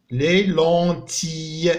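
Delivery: teacher modelling French; slow model word. Shown as a demonstration. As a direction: sound out لي لونتي for pupils. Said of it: "teacher models French words".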